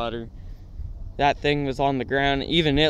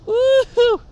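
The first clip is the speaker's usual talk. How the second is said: much higher, louder whoop of disbelief; mock whoop; 7 dB louder than usual. Narrator reacting to the crushed foot, high loud whoop. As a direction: hoot as if shocked by the sight.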